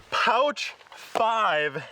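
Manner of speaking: breathless, panting a little; out of breath. Real, not acted. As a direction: say it winded and short of air. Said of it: "why am I running out of breath?".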